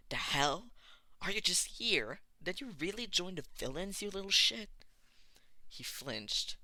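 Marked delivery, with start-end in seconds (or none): 0.1-4.7 loud, higher angry yell; spitting fury. A narrator's reading. Bakugo spitting insults at Deku, shouting. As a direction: snarl it out at him, furious. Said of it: "continuing to spit at Deku".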